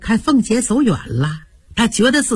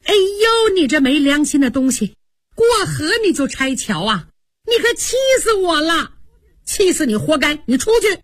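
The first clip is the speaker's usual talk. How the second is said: angry outburst; 6 semitones higher than usual. A narrator's reading. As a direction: blow up at him, shrill with anger. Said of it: "平儿一听可就火了".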